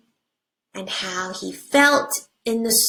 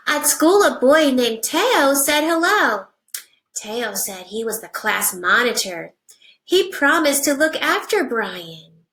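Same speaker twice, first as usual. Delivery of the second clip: put-on reading to children; 4 semitones higher, 3 dB louder than usual. Teacher reading a picture book to her class, animated storybook voice.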